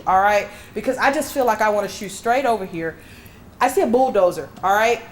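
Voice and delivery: ordinary talk, nothing marked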